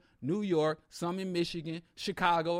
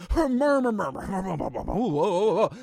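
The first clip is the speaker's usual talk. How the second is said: mock crowd murmur rising to loud oh's; 4 dB louder than usual. Shown as a demonstration. Audience murmuring at a controversial claim, mock crowd murmur. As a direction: play the whole crowd murmuring, then gasping. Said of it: "let me show you what an academic riot is like".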